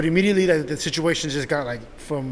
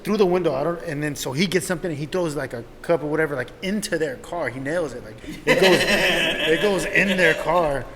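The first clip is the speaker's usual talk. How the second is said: ordinary talk, nothing marked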